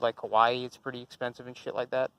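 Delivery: ordinary talk, nothing marked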